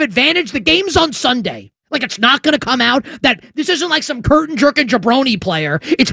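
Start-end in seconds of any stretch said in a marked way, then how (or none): none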